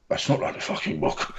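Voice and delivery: whispered indignant aside; whispered complaint. Retold or imitated. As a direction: hiss it like a grumbling fan. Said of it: "you went".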